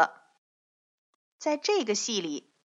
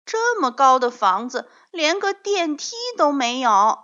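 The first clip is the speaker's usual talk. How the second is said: put-on loud, higher complaining child; 5 semitones higher, 6 dB louder than usual. A narrator's reading. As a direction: grumble loudly about the stairs. Children complain about engineer.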